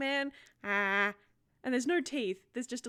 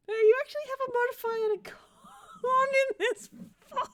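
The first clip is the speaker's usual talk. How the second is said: laughing; 11 semitones higher, 6 dB louder than usual. Real, not acted. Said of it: "I find that very interesting".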